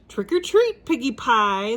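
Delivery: high, creaky granny voice; wolf playing a sweet old lady. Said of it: "in a creaky old lady voice".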